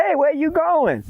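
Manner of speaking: high-pitched challenge; high, urgent hail; calling out. Acted. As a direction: call out high to stop him. Boy grabbed by friend on porch.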